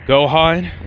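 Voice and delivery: ordinary delivery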